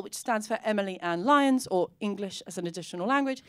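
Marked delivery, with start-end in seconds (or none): none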